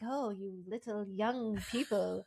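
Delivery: slow, drawn-out storyteller imitation; Grams telling a scary story. Podcaster imitating Grams addressing the young people, slow storyteller voice. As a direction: draw each word out like Grams, slowly. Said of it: "just her telling the story was".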